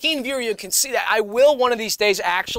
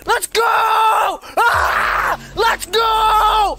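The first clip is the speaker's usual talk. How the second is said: hype shout; 8 semitones higher, slower than usual. Pretend. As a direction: scream it, fully charged. Plugged in, hyped up.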